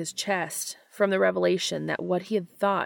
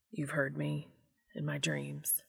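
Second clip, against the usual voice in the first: mumbled; 2 semitones lower, 10 dB quieter than usual. A narrator's reading. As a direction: mumble it into the sheets. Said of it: "Dean mumbled".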